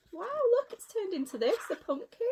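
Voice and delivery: put-on high, bright wow for a child; wide-eyed wonder; amazed. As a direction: marvel at the magic coach. Child-directed amazement at coach.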